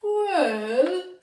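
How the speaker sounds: high loud drawn-out well; the dog starting to answer; little dog voice. A narrator's reading. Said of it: "said Bingy".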